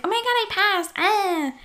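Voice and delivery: high, giddy mini-squeal; excited squeal. Retold or imitated. Telling a crush she passed her test, high excited squeal.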